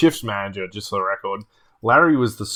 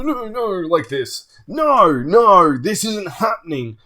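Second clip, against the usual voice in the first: high-pitched mock meltdown; imitated frantic denial; retold disbelieving rant; 7 semitones higher than usual. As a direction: play someone going nuts in denial. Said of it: "he's just gone completely nuts".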